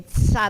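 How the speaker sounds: "tsa" with t fused into s; pizza TS. Shown as a demonstration. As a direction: stress "tsa" as the last syllable of pizza. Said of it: "é como pizza".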